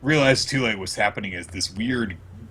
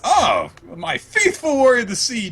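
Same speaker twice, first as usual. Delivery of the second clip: high, grand queenly address; high sweeping royal greeting; regal Big Mom; 4 dB louder than usual. Retold or imitated. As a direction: greet a loyal servant from a throne. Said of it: "She says".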